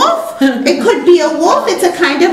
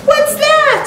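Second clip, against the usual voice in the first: much higher, delighted question; mock surprise; 13 semitones higher than usual. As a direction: act amazed to spark the toddler. Reading picture book with toddler.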